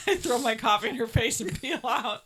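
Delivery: usual speaking voice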